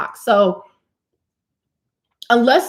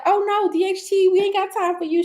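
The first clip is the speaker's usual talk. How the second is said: high mock-sassy voice talking back to DHT; the body's high, sassy reply to the hormone; 11 semitones higher than usual. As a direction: squeak it out as your own body shooing DHT away. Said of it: "my body's like".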